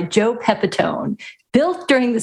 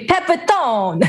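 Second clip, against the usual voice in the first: Italian flavour; 10 semitones higher, slower than usual. Shown as a demonstration. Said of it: "You're putting the right emphasis on it".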